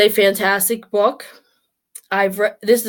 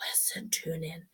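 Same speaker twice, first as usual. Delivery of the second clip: hushed whisper; soft intimate whisper, no voice; 6 semitones lower, 20 dB quieter than usual. Real, not acted. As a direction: whisper it like a secret to yourself. Urging listeners to feel inside.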